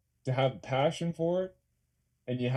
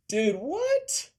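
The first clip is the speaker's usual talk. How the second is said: surprised; 14 semitones higher, 4 dB louder than usual. Real, not acted. Reacting to a surprising chat message.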